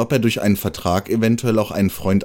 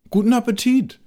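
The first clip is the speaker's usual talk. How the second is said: high, friendly little mole voice; mole's greeting; 14 semitones higher than usual. A narrator's reading. The mole wishes the squirrel a good appetite, high and bright-voiced. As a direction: chirp it up high like a small mole. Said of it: "sagte der Maulwurf".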